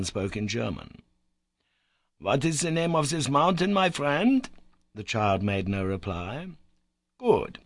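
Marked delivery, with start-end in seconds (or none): 2.2-4.5 gentle. A narrator's reading. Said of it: "tried to look as gentle as possible". 7.2-7.6 positive conviction. A narrator's reading. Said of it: "with a very positive air of conviction".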